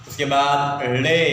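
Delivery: slow, chanting classroom lilt; slow sing-song for little pupils; sing-song teaching. Acted. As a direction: lead the children through the letters.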